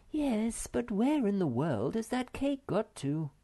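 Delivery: high-pitched exasperated despair. A narrator's reading. Moomin Mama cannot find her cake, despairing. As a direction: wail it mildly, pitched high. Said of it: "said Moomin Mama in despair".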